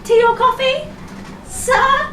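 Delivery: very high, loud rising question; insistent attendant, pitched right up; insistent cabin crew voice. Acted. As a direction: push the offer in a high service voice. Repeats offer to passenger.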